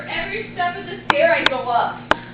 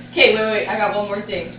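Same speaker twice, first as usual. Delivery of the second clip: ordinary delivery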